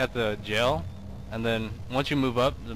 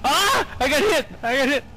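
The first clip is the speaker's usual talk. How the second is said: panicked yell; 7 dB louder than usual. Real, not acted. Hit by monster.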